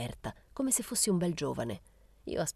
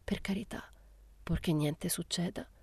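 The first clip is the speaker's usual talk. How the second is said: stammering; 2 semitones higher, 6 dB quieter than usual. A narrator's reading. Rosetta's mother fearing for her daughter, stammering. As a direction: falter softly, as if dreading the worst. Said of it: "Balbettò".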